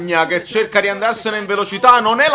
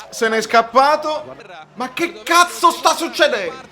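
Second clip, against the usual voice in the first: usual speaking voice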